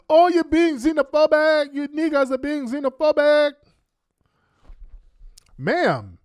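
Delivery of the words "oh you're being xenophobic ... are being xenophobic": high, loud mocking whine; mock accusation. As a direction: parody their outraged accusation. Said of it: "when we claim our ethnicity".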